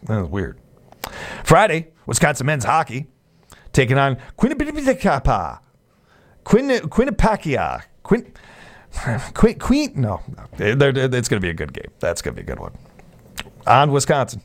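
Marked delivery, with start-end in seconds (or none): 4.4-9.9 drawn-out, mangled go at "Quinnipiac", adding extra syllables; garbled name. Pretend. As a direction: stumble over "Quinnipiac", restarting on a high "Quin-". Tripping comically over a college name.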